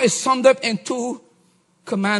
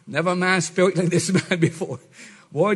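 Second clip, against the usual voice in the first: ordinary talk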